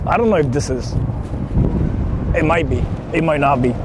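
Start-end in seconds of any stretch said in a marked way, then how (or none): none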